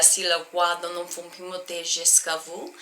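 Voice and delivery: soft, recited French accent; amateur French accent, quoting lyrics quietly. Shown as a demonstration. Singer reciting lyrics from her French piece, French accent. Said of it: "there's a French accent".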